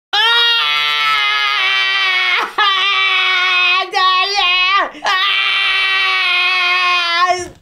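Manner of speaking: loud high wail with crying laughter; real anguished scream. Real, not acted. Player screams as the character dies. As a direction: scream out in grief. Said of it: "anguished screaming".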